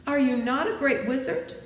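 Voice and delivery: high, loud dismayed question; aghast question. Acted. Dorothy demands whether he is a wizard, dismayed. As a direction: push it up in disbelief.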